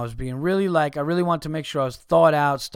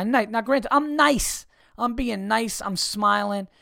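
insistent; 6 semitones higher, slower than usual. Real, not acted. Insists he was being nice.

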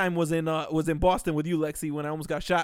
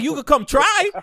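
loud, higher-pitched challenge; loud dare; 7 semitones higher, 9 dB louder than usual. Retold or imitated. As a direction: throw the dare right back at him. Daring the customer to come.

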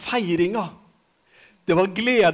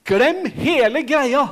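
Jesus calling out; 6 semitones higher, 6 dB louder than usual. Retold or imitated. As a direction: call the crowd away boldly. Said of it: "så er det som om Jesus sier".

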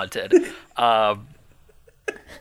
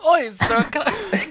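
high put-on Australian voice; hammed-up Aussie bloke asking a favour; mock Australian, laughing; Australian accent; 13 semitones higher, slower than usual. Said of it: "I think I just did Australian".

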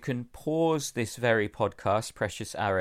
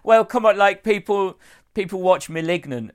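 playful protest; 10 semitones higher, 9 dB louder than usual. Real, not acted.

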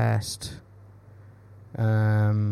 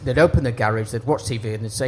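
ordinary talk, nothing marked

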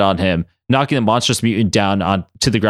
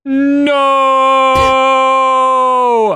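high, loud, long drawn-out no; slow Darth Vader no; 13 semitones higher, 6 dB louder than usual. Acted. Apocalypse watching the machine explode, a long, loud Vader no. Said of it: "in a slow Darth Vader fashion".

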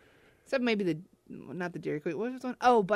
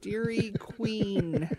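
slow repeat; 3 semitones higher, 4 dB quieter, much slower than usual. Acted.

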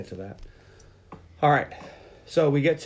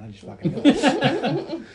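high, slow laughing brag; giggly show-off; laughing boast; 13 semitones higher, much slower than usual. Real, not acted. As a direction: crow it while laughing. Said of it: "proud laughing boast".

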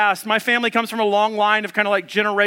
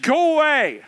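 higher-pitched imitation of wife's dismissal; mock angry wife; 6 semitones higher than usual. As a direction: send him off, lightly hammed-up. Wife refuses behind locked door.

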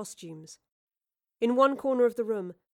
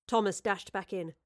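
plain reading voice

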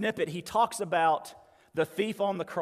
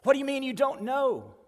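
high, baffled challenge; incredulous angel; 8 semitones higher, 3 dB louder than usual. Retold or imitated. Angel challenging the clueless thief, incredulous. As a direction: pitch it up in disbelief.

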